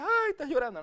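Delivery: mocking false sympathy for the sad; fake pity. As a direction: play a phony sorrowful coo. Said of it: "tiene que ser hipócrita".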